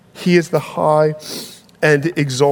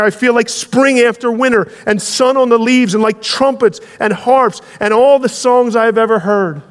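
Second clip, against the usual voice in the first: much higher and louder, bursting with joy; joyful cry; 7 semitones higher, 4 dB louder than usual. Acted. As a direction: shout it like a hobbit who just woke up happy. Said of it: "he waved his arms in the air".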